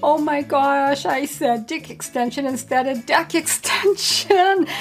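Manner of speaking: re-enacted giggly admission; laughing so hard. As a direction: gasp it out as if helpless with giggles. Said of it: "I'm laughing so hard".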